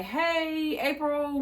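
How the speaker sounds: high, drawn-out gentle call; gentle wake-up. Retold or imitated. Friend kinda gently wakes her sleeping roommate, gentle high call. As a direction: coax a sleeper awake, imitated. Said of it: "kinda gently wake her up".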